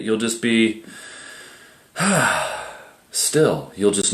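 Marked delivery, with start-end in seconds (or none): 2.0-2.7 long, breathy exhale; relaxed sigh. Shown as a demonstration. Meditator showing how it feels to be still, relaxed sigh. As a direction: let out a settling sigh. Acts out being suddenly still.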